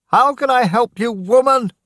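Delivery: high, loud, helpless shout; a frozen man shouting at a pleading woman; desperate shout. Acted. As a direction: yell back in despair. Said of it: "Daniel shouted at Missus Dawson".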